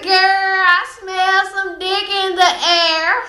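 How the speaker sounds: put-on slow, sung-out sly girlfriend; sly friend's voice. Friend sniffing the air at fifty guys, slow and sing-song.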